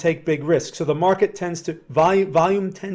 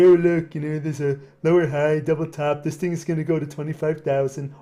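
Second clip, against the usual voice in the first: mocking fake gurus. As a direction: imitate a know-it-all guru, mildly mocking. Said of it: "make fun of them".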